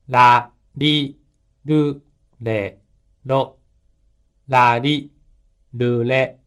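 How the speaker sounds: ラリ ルレ ロ split into even beats; each beat of ラリルレロ spaced with pauses; careful mora timing. Shown as a demonstration. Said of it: "拍に注意して発音してください。".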